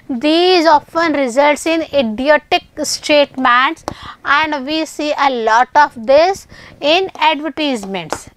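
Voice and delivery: word by word, very slow; teacher reading a grammar rule aloud to students, very slow; very slow dictation. Shown as a demonstration. Reading English text slowly.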